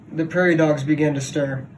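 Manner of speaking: plain reading voice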